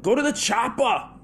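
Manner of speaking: very high, loud action-hero yell; Arnold Schwarzenegger. Acted. Action hero ordering people to the chopper, shouted high. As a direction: belt out a hammed-up movie catchphrase. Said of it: "Oh, there it goes".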